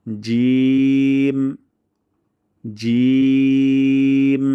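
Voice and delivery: জিম held long and loud; জিম sustained at full voice; drawn-out jim. Shown as a demonstration. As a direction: stretch জিম out slowly. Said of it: "তালুর সঙ্গে লাগিয়ে".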